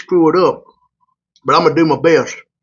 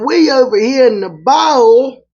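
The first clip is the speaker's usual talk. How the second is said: put-on Louisiana accent; 8 semitones higher, 3 dB louder, slower than usual. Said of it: "do this Louisiana accent".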